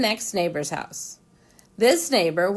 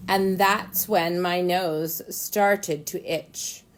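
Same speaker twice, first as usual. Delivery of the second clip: ordinary delivery, nothing marked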